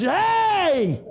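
high, slow, shouted commands; the joyful father shouts orders to his servants; father calling servants. Acted. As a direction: call out across the yard to the servants. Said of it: "called out servants".

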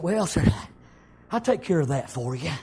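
a hint of a calm, low God. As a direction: answer gently from above. God's reply voiced.

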